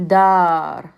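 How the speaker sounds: Dar with the ah drawn out long; elongated ah. Shown as a demonstration. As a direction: hold the ah in Dar. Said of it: "that elongated sound".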